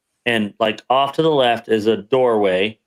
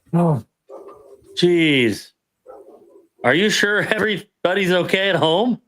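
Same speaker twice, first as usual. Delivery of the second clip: anxious worry; 6 semitones higher than usual. Retold or imitated. Recruit fears bad news.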